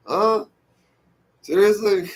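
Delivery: high disbelieving huh, seriously; can't believe what he's hearing; incredulous. Real, not acted. Vlogger reacting to the story, high and incredulous.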